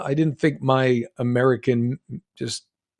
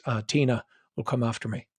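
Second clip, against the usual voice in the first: normal talking voice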